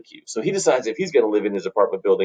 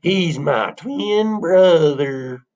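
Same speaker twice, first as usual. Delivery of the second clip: high, very drawn-out rustic announcement; hammed-up countrified drawl; country bumpkin revelation; 7 semitones higher, much slower than usual. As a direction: stretch each word for a big reveal. Plays countrified twin brother.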